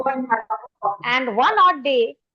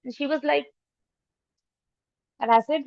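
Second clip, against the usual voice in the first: normal talking voice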